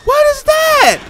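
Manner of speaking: very high, very loud shriek of alarm; startled scream. Real, not acted. Player hit by a sudden threat, screaming in alarm.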